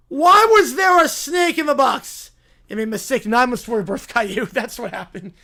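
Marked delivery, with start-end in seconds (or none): 0.1-2.3 high, loud mock protest; outraged shout. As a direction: demand it in theatrical outrage. Acted outrage at a snake.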